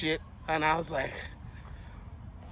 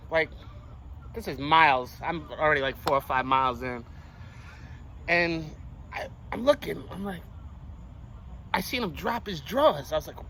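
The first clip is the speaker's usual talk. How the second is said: ordinary talk, nothing marked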